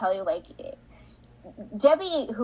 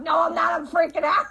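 laughing mock panic; 5 semitones higher, 4 dB louder than usual. Retold freakout, laughing.

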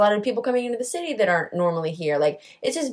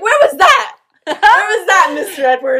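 high, loud mock complaint to the teacher; mock outraged; 10 semitones higher, 6 dB louder than usual. Student pretends to yell at history teacher, mock outrage. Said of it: "I'm like".

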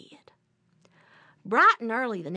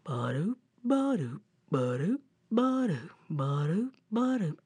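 sauntering bear footfalls; 5 semitones lower, 4 dB quieter than usual. Acted. Imitates bear sauntering along.

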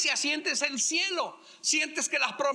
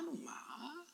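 whisper; 4 semitones higher, 18 dB quieter than usual. Pretend. Husband's sweet aside.

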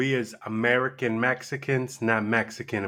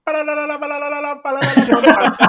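put-on much higher baby babbling; toddler babble; 8 dB louder, faster than usual. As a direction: babble like a little kid on tape. Imitating himself as toddler babbling.

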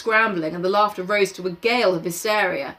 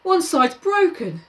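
high whiny Piggy; 10 semitones higher, slower than usual. Acted. Piggy upset about glasses.

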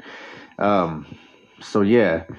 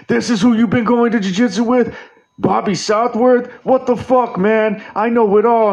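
talking dummy voice; 11 semitones higher, 4 dB louder than usual. Retold or imitated. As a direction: squeak it out like a possessed stuffed dummy mocking you. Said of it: "he's gonna show up ... and be like".

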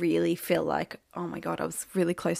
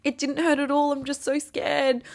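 higher, pleading reassurance; retold tearful protest; 8 semitones higher than usual. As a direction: imitate protesting through tears. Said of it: "And I just started crying".

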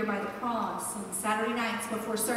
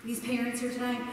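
ordinary talk, nothing marked

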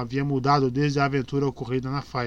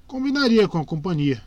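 usual speaking voice

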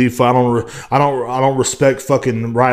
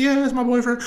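mock girlish voice; 10 semitones higher than usual. Mocking the girl's voice.

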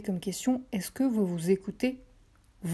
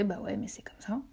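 breathy whispered shrug, half to herself; whispered aside; 8 dB quieter than usual. Real, not acted. Host shrugging off her French accent to listeners, whispered. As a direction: drop to a whisper for a resigned aside.

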